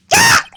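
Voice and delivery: sudden high yelp of fright; startled shriek. Acted. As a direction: jump out of your skin. Startled by returning bellboy.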